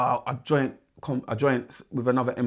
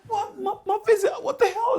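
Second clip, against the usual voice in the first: very high, laughing outrage; laughing disbelief. Retold or imitated. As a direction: squeak it through laughter. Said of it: "I'm like".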